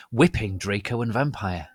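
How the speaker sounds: ordinary delivery, nothing marked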